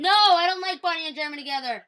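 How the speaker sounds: fast, high, emphatic objection; loud protest. Real, not acted. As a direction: jump in loudly to disagree. Bickering over favourite TV couples.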